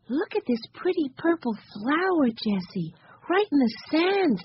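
put-on high, eager little kid; Benny's child voice. A narrator's reading. Benny showing Jessie a purple flower, high child voice. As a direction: pipe up high to show a find.

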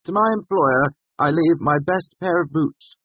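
high, grand bequest; magnanimous. A narrator's reading. Bast leaving boots to his employer, magnanimously. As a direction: bestow it with lofty generosity. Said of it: "continued magnanimously".

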